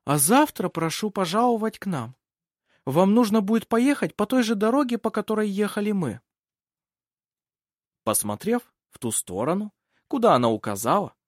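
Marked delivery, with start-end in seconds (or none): 0.0-6.2 high, emphatic noblewoman voice; raised pitch, courteous and commanding invitation; gracious hostess. Acted. Lady inviting travellers to visit tomorrow, gracious high voice.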